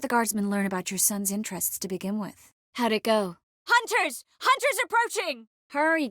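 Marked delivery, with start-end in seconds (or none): 3.7-6.1 high-pitched cry of alarm; urgent warning call. Acted. Lookout warns companions of hunters approaching, calling out urgently. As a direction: call out the danger, voice leaping up.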